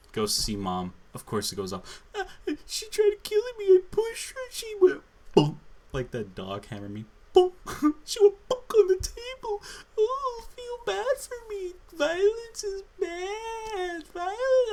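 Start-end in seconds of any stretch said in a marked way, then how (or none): 2.2-5.6 hammered-up fake sobbing, much higher and louder; mock crying. Mocking Lev's whining after mom. 7.3-14.7 hammered-up fake sobbing, much higher and louder; mock crying. Continues mocking Lev crying.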